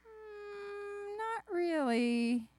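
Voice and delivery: high, slow, drawn-out hedging; reluctant confession. Acted. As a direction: squirm out a guilty child's admission. Guilty child reluctantly admitting theft.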